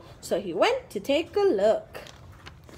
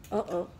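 gasp; 8 semitones lower, 8 dB quieter than usual. Acted. Storyteller gasps in suspense.

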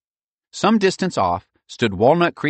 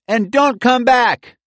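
triumphant higher, louder taunt; calling out; 9 dB louder, slower than usual. A narrator's reading. Michael calls after the fleeing bullies, taunting. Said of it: "Michael called out".

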